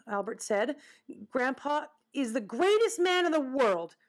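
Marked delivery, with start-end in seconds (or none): 1.3-4.0 higher-pitched, emphatic insistence; staunch defence. Acted. Albert defending Grandpa to the woodchuck, emphatic. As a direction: proclaim it with indignant pride.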